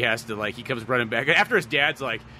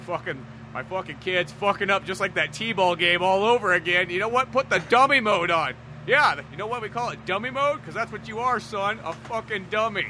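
higher, angry mock dad rant at his son; dad scolding a useless son, in a higher mock-angry voice; 7 semitones higher than usual. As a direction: rant like a fed-up father scolding his kid. Shinji's dad ranting at son.

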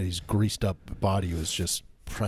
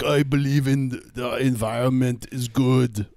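slow, higher mock Austrian accent; hammed-up Austrian accent, slow and simple; 4 semitones higher, 4 dB louder than usual. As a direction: ham up an Austrian accent, dopey. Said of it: "He's like".

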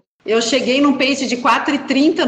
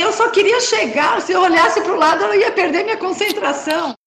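laughing retelling; 8 semitones higher than usual. Retold or imitated. Own excited reply to friend.

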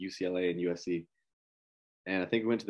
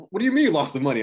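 incredulous demand; 9 semitones higher, 11 dB louder, faster than usual. Retold or imitated. Girlfriend demanding about lost money.

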